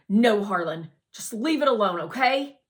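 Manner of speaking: put-on boy snapping at his friend to drop it; snapped. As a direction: bite it off, fed up. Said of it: "Raymond snapped".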